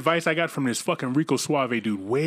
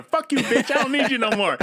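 mock brush-off; 10 semitones higher than usual. Mock telling off a woman.

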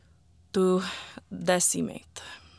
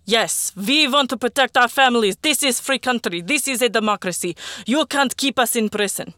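higher, louder protest shout; crowd shouting; 5 semitones higher, 5 dB louder than usual. Acted. Soldiers joining Arkady's protest, angry shouting. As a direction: yell it along with the crowd. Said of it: "I was shouting too".

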